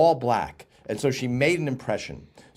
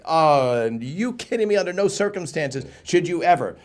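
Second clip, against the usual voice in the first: emphatic disbelief; 6 semitones higher, 3 dB louder than usual. Retold or imitated. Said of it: "he should be like".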